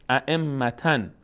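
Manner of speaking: ائمتن drawn out, doubled m, ending -tan; ائمتن at half pace with tanwin; full tanwin ending. Shown as a demonstration. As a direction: slowly sound every part of ائمتن through the final n. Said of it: "حتی تنوین ان".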